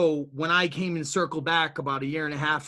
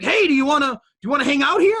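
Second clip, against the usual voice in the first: high, louder, fast friendly offer; friendly owner; 10 semitones higher, 7 dB louder, faster than usual. Retold or imitated. Owner asks him to hang out at the center, upbeat and high. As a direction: pitch the invite upbeat and quick. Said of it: "he's like".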